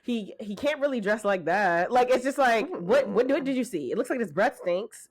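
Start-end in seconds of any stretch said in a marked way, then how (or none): none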